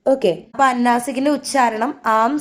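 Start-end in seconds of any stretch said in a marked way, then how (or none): none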